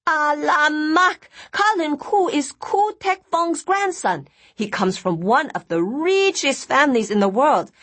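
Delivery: gushing. Acted. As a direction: gush over the royal wedding. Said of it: "Nina gushed".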